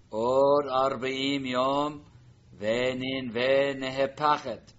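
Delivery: loud, slow proclamation; prophet's proclamation. Shown as a demonstration. Teacher reads Jonah's warning to Nineveh, loud and slow. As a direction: boom it out like a prophet warning the city. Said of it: "Now the line. Jonah's message".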